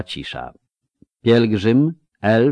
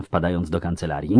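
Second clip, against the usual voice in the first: ordinary delivery, nothing marked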